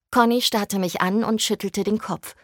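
plain reading voice

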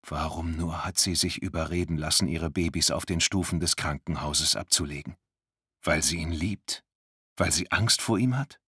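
ordinary delivery, nothing marked

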